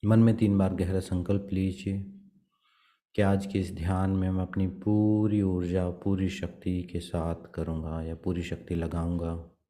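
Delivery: soft, even meditation voice with long pauses; guided meditation. Acted. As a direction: guide them gently into prayer, leaving long silences. Leads meditation and prayer. (शांत, धीमे स्वर में ध्यान का निर्देशन)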